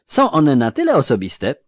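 a lightly voiced earnest youth; curious young clerk; young scribe voice. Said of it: "uciął Jare".

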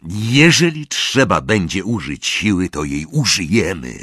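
ordinary delivery

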